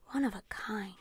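whispered to himself, wistful. A narrator's reading. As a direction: breathe it out alone by the bike. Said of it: "he said to himself".

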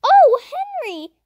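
slow, loud delighted cry; cried out. A narrator's reading. Sisters thrilled at brother's news, slow loud cry. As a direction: cry his name in delight. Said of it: "cried Violet and Jessie".

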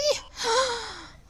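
a sharp, high frightened gasp. Real, not acted. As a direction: gasp in terror. Fright in the dark. (cri étouffé de peur)

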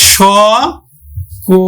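স as sh, then কো with an o sound; syllable by syllable. Shown as a demonstration. Teacher showing the sh sound to students, syllable by syllable. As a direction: say স like sh, then কো, separately. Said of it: "তালব্য স উচ্চারণ করি".

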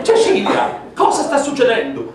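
put-on high, startled old woman; alarmed grandmother. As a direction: call out like a frightened granny. Grandmother calling in the dark.

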